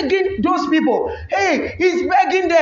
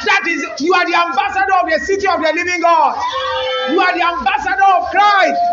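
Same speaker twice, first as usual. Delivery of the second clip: impassioned preaching; 3 semitones higher than usual. Real, not acted. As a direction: proclaim it with rising fervour.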